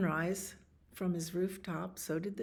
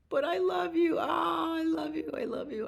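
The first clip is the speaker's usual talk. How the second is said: high, gushing repeated plea; pleading outpouring; 11 semitones higher than usual. Acted. Man in group hug with animals, high pleading. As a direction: pour it out high, then come down.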